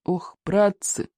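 slow, dismayed moan; groaning.

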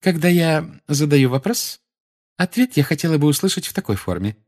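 high, saccharine lecturing; sugary Umbridge voice. A narrator's reading. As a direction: lecture with condescending sweetness. Umbridge insists.

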